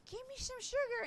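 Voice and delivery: high, put-on old granny voice; grandma voice. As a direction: croon it like a clingy old grandma. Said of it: "be like".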